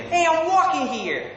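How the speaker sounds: very high, brash American "wawkin' here"; American accent. Shown as a demonstration. Brit trying film line, brash American accent. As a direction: protest it as an indignant American. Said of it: "my best American accent".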